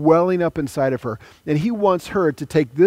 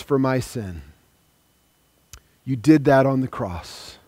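prayer. Acted. Leads a sinner's prayer.

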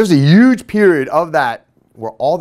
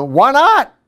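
surprised why not; 8 semitones higher, 4 dB louder than usual. Retold or imitated. Narrator blurts question at kid.

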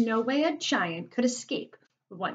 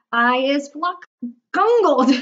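loud shout leaping higher on the last word; screaming giant; 5 semitones higher, 10 dB louder, slower than usual. A narrator's reading. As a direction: break off, then scream the final word. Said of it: "screamed the maid masher".

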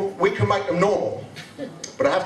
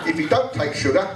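ordinary talk, nothing marked

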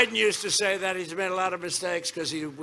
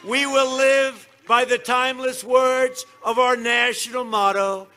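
booming campaign rally delivery; rally speech; 5 semitones higher, 3 dB louder than usual. Acted. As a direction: shout it to a packed arena.

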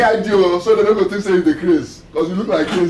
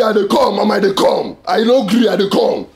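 usual speaking voice

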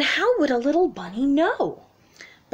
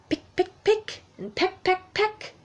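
pecking sounds; 7 semitones higher than usual. A narrator's reading. Duck hatching, pecking.

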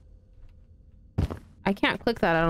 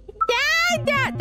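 much higher whiny Dad, Dad; high whiny mock call for dad. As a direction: squeak Dad like a kid calling. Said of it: "that doesn't sound like me".

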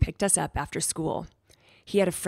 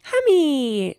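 put-on high, loud toddler shout; squealing little-kid holler; little child crying out; 9 semitones higher, 7 dB louder than usual. A narrator's reading. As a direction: cry it out excitedly like a tiny boy. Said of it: "Derek cried".

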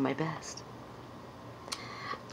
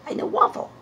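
squeaky, much higher and louder puppet; 6 dB louder, much faster than usual. Acted. Puppet insisting it knows Waffle, in a loud high squeak. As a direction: push it up into a loud cartoon squeak. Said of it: "I want to sound like".

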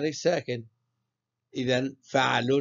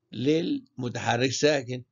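ordinary talk

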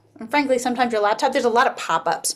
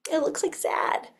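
sad face voice; 4 semitones higher, 6 dB quieter, slower than usual. Shown as a demonstration. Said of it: "If you're sad".

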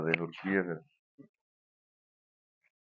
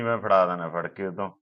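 ordinary talk

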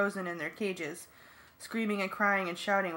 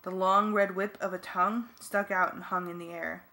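plain reading voice

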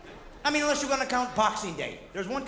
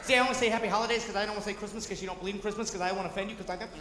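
fast, lower run-on mock explanation; nervous rambling; 3 semitones lower, 5 dB quieter, much faster than usual. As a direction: rattle off excuses in one anxious breath. Acts a nervous, over-careful explainer.

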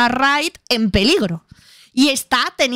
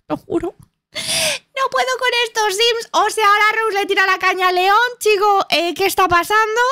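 can't believe these sims, pitched way up; exasperated; 9 semitones higher than usual. Real, not acted. Player to viewers, exasperated disbelief.

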